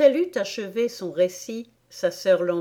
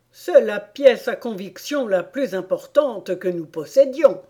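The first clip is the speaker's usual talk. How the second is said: louder, emphatic storybook king; self-satisfied king; 2 semitones higher, 7 dB louder than usual. A narrator's reading. The king to the court, rubbing his hands, self-satisfied. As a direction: proclaim it as a lightly voiced king. King triumphant over the evidence.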